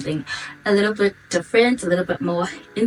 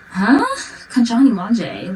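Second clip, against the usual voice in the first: baffled; 6 semitones higher, 6 dB louder, slower than usual. Retold or imitated. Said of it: "I'm always just like".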